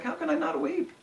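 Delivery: high, thin retold lament; imitated weeping. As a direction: imitate a preacher breaking into tears. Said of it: "just starts weeping over it again".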